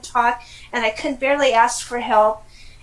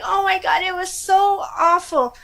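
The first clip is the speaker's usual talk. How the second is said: lingering exasperation; 6 semitones higher than usual. Retold or imitated. Still exasperated after outburst.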